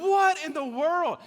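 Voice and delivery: high, loud disbelief; bewildered outburst. Retold or imitated. Camp speaker baffled by the night's chaos, loud bewildered cry. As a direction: exclaim it in utter bafflement.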